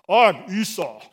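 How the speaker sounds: loud man's man voice; Esau. Acted. Esau the hunter introducing himself, man's man voice. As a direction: put on a loud, macho hunter's voice. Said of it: "this is how I picture Esau's voice".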